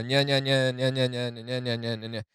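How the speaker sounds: mock schoolyard nya-nya chant; sing-song teasing like a kid on a playground; childish taunt. Mocking rap beef as kids' back-and-forth, a childish taunt. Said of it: "Just becomes a, you know".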